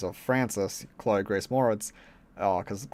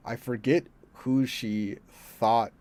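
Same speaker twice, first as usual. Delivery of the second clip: ordinary delivery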